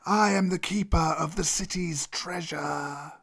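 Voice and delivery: drawn-out cobra proclamation; proud old cobra. Acted. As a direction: intone it as the treasure's guardian. Cobra guards its treasure.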